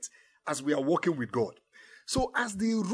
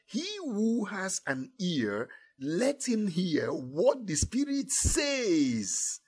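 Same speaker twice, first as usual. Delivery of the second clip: slow solemn reading; much slower than usual. Real, not acted. Solemn scripture recitation.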